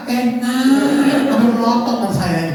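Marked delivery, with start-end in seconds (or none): 0.1-1.2 higher, long-held insincere approval; forced praise. Retold or imitated. Husband placates glaring wife, drawn-out forced praise. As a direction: sing it out as dutiful praise. Suami terpaksa memuji, istri melotot.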